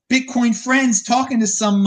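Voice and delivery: usual speaking voice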